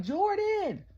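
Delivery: very high, loud scream of a name; high excited fan scream. Retold or imitated. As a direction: shriek the name like a thrilled fan. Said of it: "the way I just screamed".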